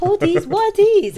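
high, slow mock wonder; a hint of a nosy stranger; mock curious stranger. Mimicking hair-touching stranger.

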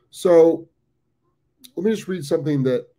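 talking through hiccups; hiccupping between words. Real, not acted. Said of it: "I've got the hiccups".

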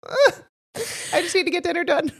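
high, put-on little girl voice, laughing through it; whiny little girl. Mom imitating her little daughter, high and whiny. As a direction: play the child sighing over her chores.

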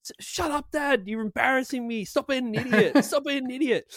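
put-on a teen telling dad off; embarrassed daughter. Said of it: "Ness is going like".

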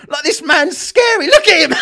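high, loud, laughing disbelief; laughing incredulity. Real, not acted. Reacting to a bullying debater's clip, laughing incredulity. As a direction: exclaim through laughter, pitched right up.

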